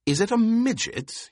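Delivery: high, startled question; astonished, half to himself; shocked. A narrator's reading. Baron's shocked inner question.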